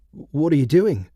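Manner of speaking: lightly voiced puzzled boy; a kid confused by a stranger; boy asking. A narrator's reading. Boy asks Theo why he unlaces his boots, lightly puzzled. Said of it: "the boy asked".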